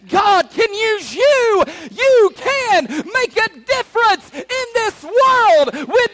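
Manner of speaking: slow, high, shouted sermon; thunderous preacher's shout; full-force preaching climax. Real, not acted. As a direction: belt it out to the whole congregation, stretching the key words.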